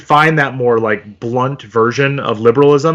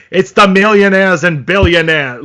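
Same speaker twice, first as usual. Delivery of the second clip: loud, higher, blunt grumpy-neighbor voice; grumpy old-neighbor politician impression; Bernie Sanders; 5 semitones higher, 5 dB louder than usual. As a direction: do a thick-accented blunt politician. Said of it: "I think that Bernie speaks".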